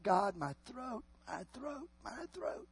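high, very soft strained plea; imitated weeping. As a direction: act out a faint, tearful cry to God. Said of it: "he begins just to weep and cry".

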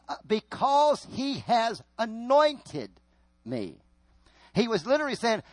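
loud, high preacher's declamation; forceful preaching. Acted. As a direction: proclaim it to the congregation, voice lifted.

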